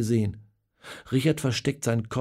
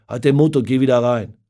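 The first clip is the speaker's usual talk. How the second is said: curt snap; 9 dB louder than usual. Acted. Richard snapping at Benno.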